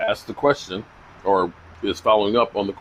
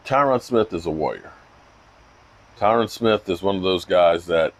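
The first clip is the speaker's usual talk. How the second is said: ordinary talk, nothing marked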